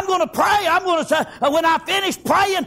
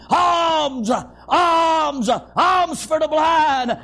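long, drawn-out beggar's call for alms; begging cry; much slower than usual. Acted. Blind beggar at the roadside to passers-by, begging cry. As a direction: call out slowly for charity, stretching each word. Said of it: "uh, uh, begging".

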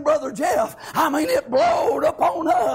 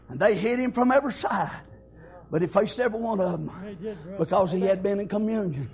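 hushed preaching; 7 semitones lower, 4 dB quieter than usual. Acted. Preacher to the congregation about praying before the storm, hushed. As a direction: pull the room in close and quiet.

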